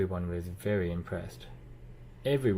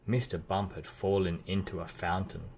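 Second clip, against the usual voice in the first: ordinary delivery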